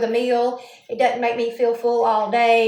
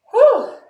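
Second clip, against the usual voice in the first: loud whew; 9 semitones higher, 9 dB louder than usual. Real, not acted. Sleepy, tired exhale.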